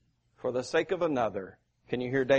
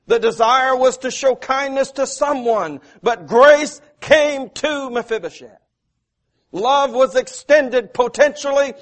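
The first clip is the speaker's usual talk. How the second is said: loud, high, full-force preaching; rising preacher's cadence; 8 semitones higher, 9 dB louder than usual. Acted. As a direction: drive it home from the pulpit.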